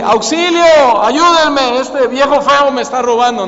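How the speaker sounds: high, loud mock alarm call; shout for help. Father shows children how to call out if grabbed, loud shout. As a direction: yell for rescue, first word stretched high. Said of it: "Mejor grita fuerte".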